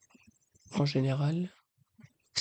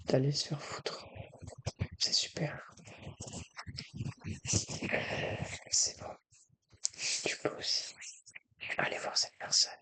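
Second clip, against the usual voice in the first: whispering; 6 dB quieter than usual. Real, not acted.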